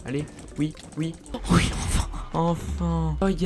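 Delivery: usual speaking voice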